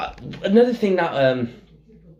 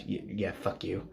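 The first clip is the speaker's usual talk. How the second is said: very low-volume grudging mutter; muttered aside; 3 semitones lower, 13 dB quieter than usual. Retold or imitated. Film fan reacting to Tom Hanks's award, muttered. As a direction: swear softly under your breath.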